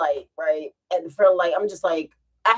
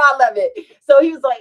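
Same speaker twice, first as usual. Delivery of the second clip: normal talking voice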